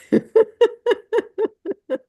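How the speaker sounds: a short high laugh at herself; embarrassed giggle; sheepish laugh. Real, not acted. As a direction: laugh off being a novice. Admits barely getting by in Canva.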